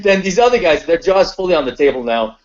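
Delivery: ordinary talk, nothing marked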